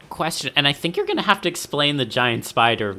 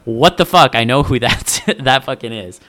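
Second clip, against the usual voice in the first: retold surprise; 2 semitones higher, 6 dB louder than usual. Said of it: "I was like".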